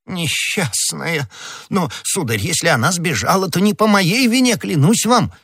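high, agitated protest of innocence; distraught pleading. Acted. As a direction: plead desperately, pitch climbing as you go. Arrested husband pleads his innocence.

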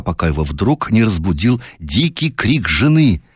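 plain reading voice

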